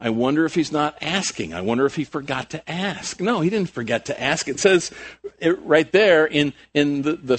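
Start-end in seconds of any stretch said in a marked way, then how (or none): none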